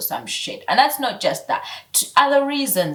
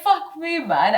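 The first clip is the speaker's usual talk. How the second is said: ordinary delivery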